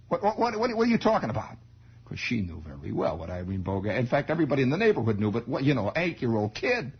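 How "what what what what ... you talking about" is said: higher sharp mother's voice; alarmed mother. Retold or imitated. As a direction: stop him short, startled.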